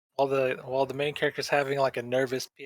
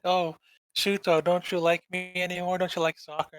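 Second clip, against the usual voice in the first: whiny clingy friend; 6 semitones higher than usual. Retold or imitated. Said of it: "he's like".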